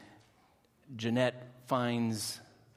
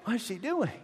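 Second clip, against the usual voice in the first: put-on bewildered kids; 8 semitones higher than usual. Kids staring at dancing mom.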